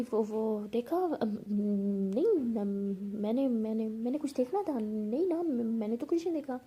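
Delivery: hesitant stammering with ums; flustered denial. Acted. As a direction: stumble through a guilty denial. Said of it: "तो ऐसे हड़बड़ी में room से बाहर". (हड़बड़ा कर हकलाते हुए इनकार)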